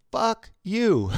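high, loud, drawn-out mock disbelief; playful cursing. Imitating customer floored by the same street, loud playful incredulity. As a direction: punch it up high, only playing. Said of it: "in a nice way. Like, he's just playing".

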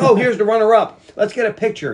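chirpy photo-op announcement; bright mock announcer.

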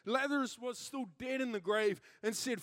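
ordinary talk, nothing marked

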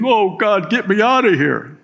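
high, pleading cry to God; desperate plea. Retold or imitated. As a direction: beg to be rescued, half mocking. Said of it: "they're thinking".

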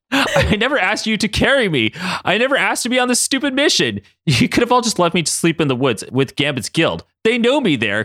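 high-pitched, exasperated complaint; frustrated. Acted. Katie complains about being dragged on a mission, frustrated.

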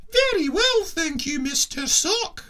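very high falsetto lady puppet; lady sock puppet voice. Acted. Captain voicing Missus Sock in falsetto. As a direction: trill it like a dainty puppet lady. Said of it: "doing the voices".

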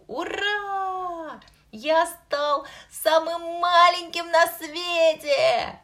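high, loud delighted cheer; joyful exclamation. Acted. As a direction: cheer like a thrilled little boy. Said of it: "воскликнул мальчик". (радостный возглас)

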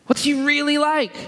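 high-pitched, insistent wondering; wondering question. Acted. Pastor voicing doubts about God, high questioning voice. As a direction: ask it high, pressing the question.

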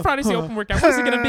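loud, high mock panic, building; frantic quoted athlete. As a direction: hammer out the question like a worked-up athlete. Acting out people fretting over workout.

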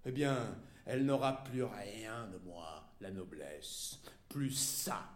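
very low, quiet, halting threat; cold menace. A narrator's reading. Wife cutting off the noble she keeps, cold menace.

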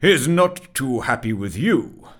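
stern mutter. Acted. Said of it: "muttered the clerk sternly".

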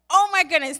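high, loud burst of relief; mock rapture of relief; overjoyed gasp. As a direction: blurt it out like a thrilled gasp. Retelling relief at being helped.

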